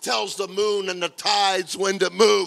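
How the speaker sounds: shouted, high, drawn-out preaching cry; full-force preaching. Real, not acted. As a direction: thunder it to the whole congregation, slowly.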